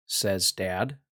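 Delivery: usual speaking voice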